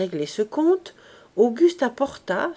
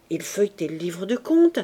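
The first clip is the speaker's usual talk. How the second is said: usual speaking voice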